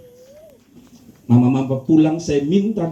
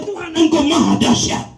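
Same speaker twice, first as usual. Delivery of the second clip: exalted praise; 10 semitones higher than usual. Pretend. Louder snore meaning praise.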